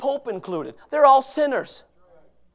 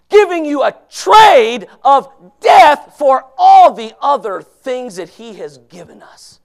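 normal talking voice

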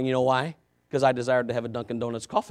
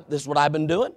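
usual speaking voice

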